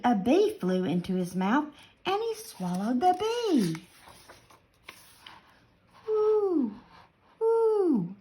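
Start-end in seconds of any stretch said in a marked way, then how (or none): 6.1-8.1 high hooting owl call. Acted. Fat old owl scolding the yawning rabbit, hooting high. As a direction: hoot it out like an owl, high and long. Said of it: "said a fat old owl".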